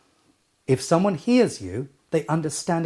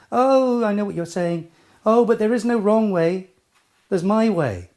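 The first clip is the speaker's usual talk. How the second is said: higher, louder protest in an objector's voice; 6 semitones higher, 4 dB louder than usual. Acted. Listener objecting to the coach's rule, protesting. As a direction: object indignantly, pitched up.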